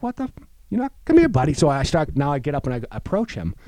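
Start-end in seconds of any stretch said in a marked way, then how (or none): 0.0-1.5 much higher, louder exasperated call; insisting the dog come, half annoyed; loud high call to a stubborn dog; loud frustrated coax. Retold or imitated.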